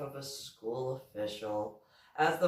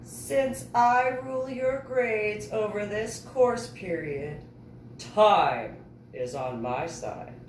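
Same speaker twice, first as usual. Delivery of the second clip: jesting; 7 semitones higher than usual. A narrator's reading. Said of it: "Inri jested".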